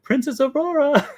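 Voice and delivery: high, loud singsong call; Mary Poppins. Retold or imitated. Nanny calling over the princess, high hammed-up call. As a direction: hail her in a shrieky mock-nanny trill. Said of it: "then she's like".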